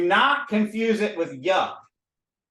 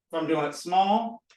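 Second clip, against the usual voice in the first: ordinary talk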